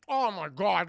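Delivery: loud, gushing food delight; a man's overdone rapture over a malt. Retold or imitated. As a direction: rave about the taste in a put-on voice. Mansley voiced tasting the malt.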